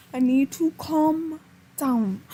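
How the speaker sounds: ordinary talk, nothing marked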